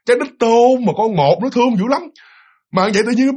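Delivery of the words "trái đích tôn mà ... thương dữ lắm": ordinary delivery, nothing marked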